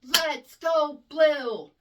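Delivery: the unwanted slow version; dragged-out cheer. Shown as a demonstration. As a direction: stretch every word lazily. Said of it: "We don't want".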